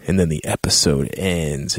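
ordinary talk, nothing marked

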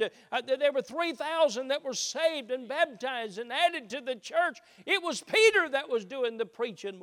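loud, high preacher's plea, slowing on the climax; full-force preaching. Acted. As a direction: build to a loud, high, pleading call.

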